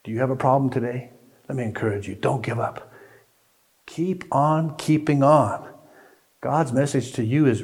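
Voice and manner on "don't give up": ordinary delivery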